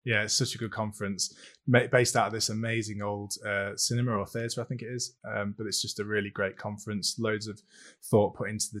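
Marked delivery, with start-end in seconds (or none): none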